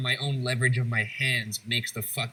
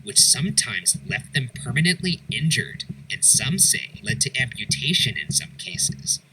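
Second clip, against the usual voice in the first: firm, raised, scripted voice-over; documentary narration; 5 dB louder than usual. A narrator's reading. Host telling viewers of the mad chair, documentary narration. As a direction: narrate grim facts with punched emphasis.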